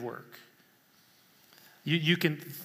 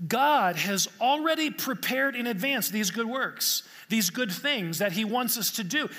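higher, louder preaching that climbs to a peak; fervent preaching; 5 semitones higher, 3 dB louder than usual. Acted. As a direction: drive the point home from the pulpit. Preaching at full force.

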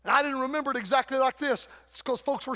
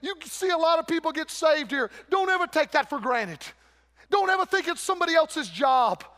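ordinary talk, nothing marked

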